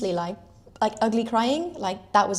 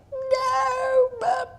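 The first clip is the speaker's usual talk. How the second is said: a high, cracking sob of a no; crying no; 4 dB louder than usual. Real, not acted. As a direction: blurt it out while breaking into tears. Crying in supermarket, stranger asks.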